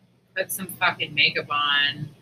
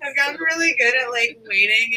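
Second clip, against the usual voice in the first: laughing; 6 semitones higher, 3 dB louder than usual. Real, not acted. Laughing after friend's joke.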